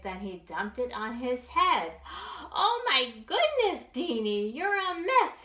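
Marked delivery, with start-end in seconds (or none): 2.0-2.5 sharp high gasp; mama's gasp. Acted. Mama gasps at her muddy son. 2.5-5.4 high, loud shocked mama; mama's voice. Acted. Mama scolding her messy little son, high and loud. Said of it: "his mama said".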